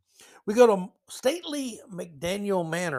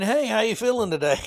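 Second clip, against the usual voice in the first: mock cheery caller; 6 semitones higher, 4 dB louder than usual. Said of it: "the government calling you and saying".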